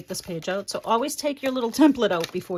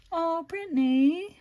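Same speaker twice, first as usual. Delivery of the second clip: touched; 7 semitones higher, 4 dB louder, slower than usual. Real, not acted. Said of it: "you're gonna make me cry".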